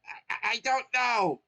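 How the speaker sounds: high, loud stammering mushroom voice; injured Toad, stammering in a high voice; weak Toad. Acted. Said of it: "Toad speak weakly".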